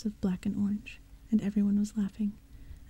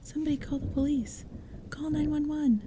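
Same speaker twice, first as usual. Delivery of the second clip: panicked call; 6 semitones higher than usual. Acted. Panic after the murder.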